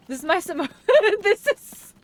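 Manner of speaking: high, words collapsing into laughter; breaking up laughing. Real, not acted. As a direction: try to quote it but crack up. Breaks up laughing while quoting.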